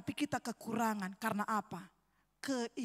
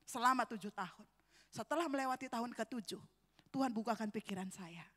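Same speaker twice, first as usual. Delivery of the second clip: ordinary talk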